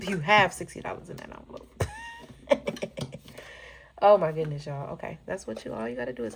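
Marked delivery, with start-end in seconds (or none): 1.9-3.9 a big burst of high laughter; laughing. Real, not acted. Laughing at her own mistake over the envelope, bursting out. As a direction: let a real laugh burst out.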